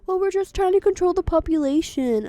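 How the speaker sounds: loud, high mock excuse; mocking hunters. Mimicking hunters who claim population control, loud mockery. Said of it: "I hate that they go".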